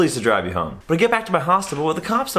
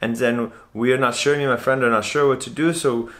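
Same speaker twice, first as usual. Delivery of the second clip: put-on hesitant, accented roommate recounting the night; halting foreign-accented storyteller, growing more worked up; foreign roommate impression. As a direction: imitate an uneasy foreign bunkmate. Plays foreign hostel roommate.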